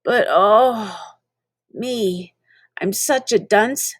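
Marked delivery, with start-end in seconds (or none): none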